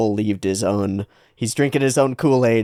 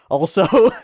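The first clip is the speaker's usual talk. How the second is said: bright sudden lift on also; perky playful turn; 8 semitones higher, 5 dB louder than usual. Acted.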